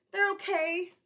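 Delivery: high, loud forced reassurance; boy insisting. Acted. Boy pretending tight shoes fit, high and strained. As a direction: push it out through the pain.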